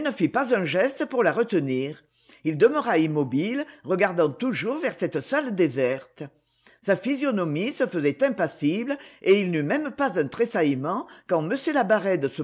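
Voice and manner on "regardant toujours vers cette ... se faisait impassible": ordinary delivery, nothing marked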